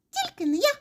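very high, tiny mouse squeak. A narrator's reading. First mouse dodges kneading, squeaking. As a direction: squeak a quick refusal. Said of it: "пропищав верть".

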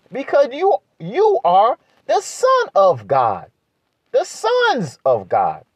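high, fervent, slowed preaching climax; preaching at full force. Acted. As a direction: drive the point home, voice soaring. Sermon climax, sustained high fervour.

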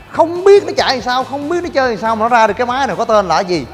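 ordinary talk

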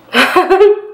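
bright high laugh; a quick laugh that rises at the end; high giggle. Real, not acted. Laughs after asking for thumbs up.

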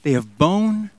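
ordinary talk

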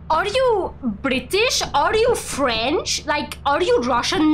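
mock questioning, lifted and slowed; imitating guessing chat. Streamer mimics chat guessing their accent, mock questioning. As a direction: draw out each guess, imitating the askers. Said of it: "you'll be like".

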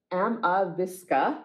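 plain reading voice

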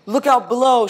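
high and loud warning; shouted. A narrator's reading. Owl jumping into leaves, shouted warning. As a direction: shout it gleefully while leaping. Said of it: "shouted Owl".

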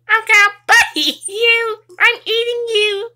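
squeaky high biting pet voice; high creature voice. Pretend. Pet voice threatens to bite.

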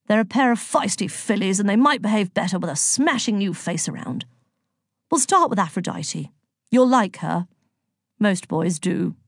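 put-on hearty old-boy chap; blustery, genial god-in-charge voice; Zeus, jovial and bossy. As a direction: lay out the plan with breezy gusto.

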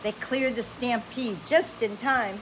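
bright, animated read-aloud voice; lively storybook reading. Acted. Parent reading an adventure story to a child, animated.

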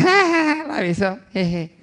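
acted tittering, starting very high; mock giggling parent. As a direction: egg the child on through giggles. Parents laughing at child's kiss, acted.

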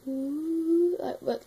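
high sung howl; wolf howl. Shown as a demonstration. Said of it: "same pitch or tone as a wolf".

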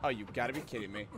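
ordinary talk, nothing marked

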